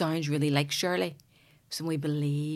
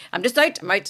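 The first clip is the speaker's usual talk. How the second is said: husband storming off; 5 semitones higher, 6 dB louder than usual. Retold or imitated.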